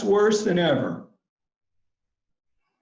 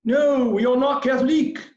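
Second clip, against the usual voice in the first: mock French accent, much higher and louder; strong French accent; 9 semitones higher, 4 dB louder than usual. Young Frenchman brushing off the missionary, strong French accent. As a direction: play the French young man, thick French accent. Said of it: "in a strong French accent".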